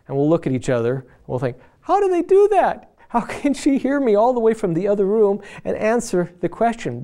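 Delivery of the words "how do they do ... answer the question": high-pitched, incredulous outburst with a laugh; mock amazement. Husband marvelling with his friend at wife's hearing, amazed. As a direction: squeal it in baffled disbelief.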